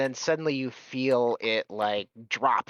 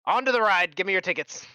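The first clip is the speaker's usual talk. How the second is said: dwarf ride operator; 7 semitones higher, 3 dB louder, faster than usual. Acted.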